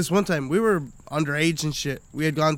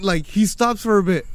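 usual speaking voice

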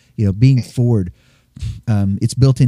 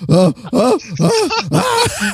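startled yelp; 12 semitones higher, much slower than usual. Retold or imitated. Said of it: "and this guy goes".

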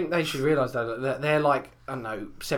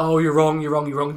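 mock indignant; 8 dB louder than usual. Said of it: "she was like".

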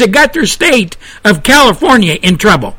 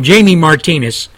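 ordinary talk, nothing marked

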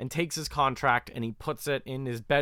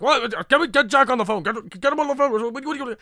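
much higher, loud panicked orders; hammed-up frantic barking of orders; frantic demand; 11 semitones higher, 7 dB louder, faster than usual. Villain demanding the housekeeper fetch Jack, frantic.

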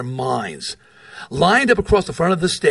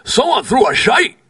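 imitated Scottish brogue, higher and insistent; 7 semitones higher, 4 dB louder than usual. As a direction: repeat it in Big John's Scottish brogue. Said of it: "in his thick Scottish brogue".

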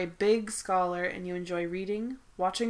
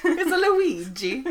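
mock Luigi accent; 7 semitones higher, 8 dB louder than usual. Joining the Luigi joke.

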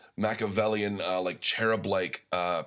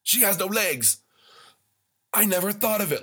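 worked-up disbelief; 9 semitones higher than usual. Real, not acted. Outraged at the statue discovery.

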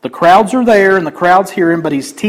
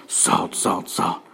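whisper; 10 semitones lower, 10 dB quieter, much slower than usual. Pretend. Mimics people pouring salt.

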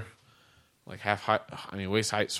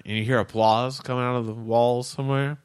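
ordinary talk

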